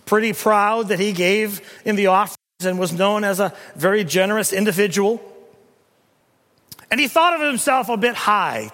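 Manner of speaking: loud, ringing pulpit delivery; thundering preacher building to a peak; preaching at full force. Acted. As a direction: proclaim it to the whole church. Preacher mocking the proud Pharisee.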